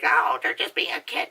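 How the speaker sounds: mocking, shouted parent voice; dismissive parent. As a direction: parody a careless parent brushing it off. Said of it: "They're like".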